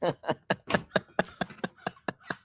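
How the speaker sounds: breathless high laugh with no voice; silent wheezing laugh. Real, not acted. Reading a viewer's joke, silent wheezing laugh.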